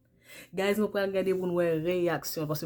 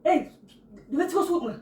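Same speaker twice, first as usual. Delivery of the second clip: loud frantic shout; 6 semitones higher, 7 dB louder than usual. Acted. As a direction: shout to get it off. Acting possessed.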